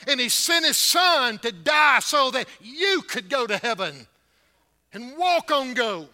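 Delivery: loud, high, ringing pulpit voice; full-force preaching. Acted. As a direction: preach it hard to the congregation.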